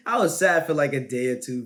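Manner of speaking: laughing through the words. Real, not acted. Said twice, second laughing.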